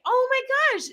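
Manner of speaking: high delighted exclamation; excited gasp. Real, not acted. Wife gushing at a viewer's comment. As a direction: gush at the comment.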